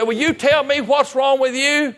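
loud, high, drawn-out exasperated shout; fed-up demand. Retold or imitated. Preacher confronting the silent young man, exasperated shout. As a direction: demand an answer at the top of your patience. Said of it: "That's where I was at".

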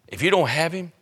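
normal talking voice

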